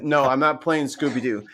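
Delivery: ordinary delivery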